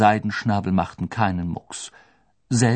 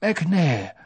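high, cheerful, teasing question; lightly mocking old headmaster, pleased; amused; 8 semitones higher than usual. A narrator's reading. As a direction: ask it with open enjoyment. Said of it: "seine Stimme klang recht vergnügt".

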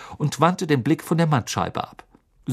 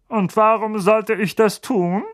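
suspicious uncle; 8 semitones higher, 4 dB louder than usual. A narrator's reading. Vernon refuses.

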